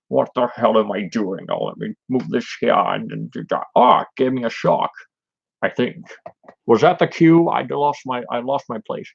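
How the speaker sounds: lightly voiced baffled Connery; puzzled, fumbling on set; confused actor. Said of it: "Sean Connery probably like".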